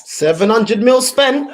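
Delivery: slow, loud mock laugh; bitter laughing. Real, not acted. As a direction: laugh at the wasted money. Laughing at spending.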